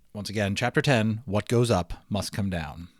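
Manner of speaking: plain reading voice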